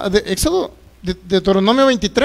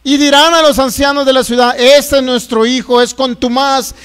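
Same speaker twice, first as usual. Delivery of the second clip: forceful scripture reading; 2 semitones higher, 5 dB louder than usual. Acted. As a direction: proclaim the parents' accusation word by word. Reads scripture aloud. (lectura bíblica fuerte, lenta y enfática)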